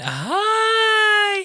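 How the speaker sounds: very high, loud, camp "hi"; camped-up greeting. Retold or imitated. Fan camping it up to greet [name]. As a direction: camp it right up. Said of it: "my strategy is gonna be camp up a bit".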